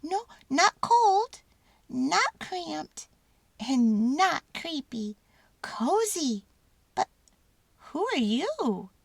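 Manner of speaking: high, squeaky mouse, slow at first; a tiny mouse, pleased, then curious; little mouse testing out a cozy spot. A narrator's reading. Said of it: "Mortimer had never seen".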